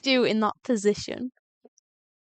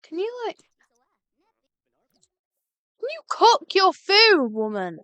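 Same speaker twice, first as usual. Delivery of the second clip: exasperated; 6 semitones higher, 5 dB louder than usual. Real, not acted. Yelling at slow Sim.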